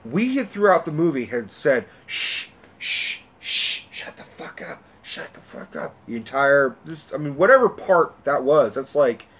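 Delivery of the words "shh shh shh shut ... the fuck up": irritated hushing in a whisper; whispered shushing. Retold or imitated. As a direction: re-enact hissing at someone to be quiet. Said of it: "we're all shushing her".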